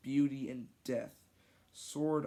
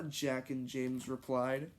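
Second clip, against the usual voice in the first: ordinary delivery, nothing marked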